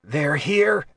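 tense, fearful question; tight with fear. A narrator's reading. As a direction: voice tightening in alarm. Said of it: "his voice went tight with fear".

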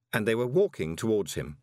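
usual speaking voice

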